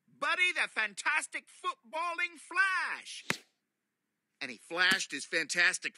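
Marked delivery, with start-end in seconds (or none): 0.1-3.2 high, loud grand hero voice; superhero announcement. Acted. Buddy announcing his superhero name, grandly.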